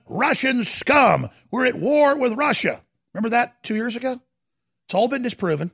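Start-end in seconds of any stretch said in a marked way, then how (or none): 0.1-2.8 high-pitched mock commentator rant; Keith Olbermann. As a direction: mimic an alarmist broadcaster, pitched up and drawn out. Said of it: "Remember Keith Olbermann?".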